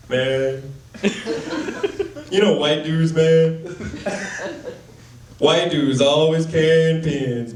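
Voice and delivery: lightly voiced, slow, nervous open-mic comic doing a put-on voice; hesitant mock stand-up with long pauses; bad impression of a comedian.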